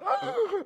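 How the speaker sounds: loud, high-pitched mock gasp; hammed-up intake of horror; imitated shocked gasp. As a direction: gasp like an alarmed mother. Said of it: "She's like".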